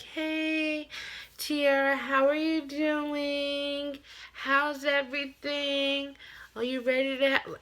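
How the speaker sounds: high, slow, sugary doctor voice; leisurely sing-song doctor. Retold or imitated. Doctor strolls in greeting the mother in labor, slow high sugary voice. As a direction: drag it out breezy and unbothered. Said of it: "taking her sweet, precious time".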